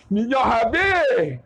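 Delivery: long drawn-out mock wail; slow, comically exaggerated complaint; exasperated punchline. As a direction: stretch each word out in despair.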